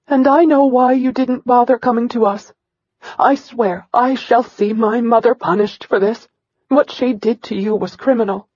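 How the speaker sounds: a mother's fierce vow of justice; indignant mother. Acted. Mother vows punishment.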